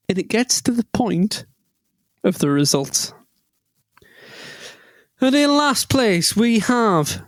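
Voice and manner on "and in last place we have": high, slow mock announcer; quiz host announcing. Quiz host reads results to pub teams, mock announcer voice. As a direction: draw it out like a host reading results.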